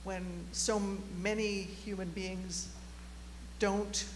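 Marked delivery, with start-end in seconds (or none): none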